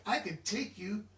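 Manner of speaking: usual speaking voice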